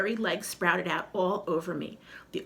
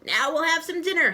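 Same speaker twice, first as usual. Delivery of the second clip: high, louder gloating skeleton; cackling skeleton; 9 semitones higher, 6 dB louder than usual. Acted. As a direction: gloat over the coming meal. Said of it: "he cackled".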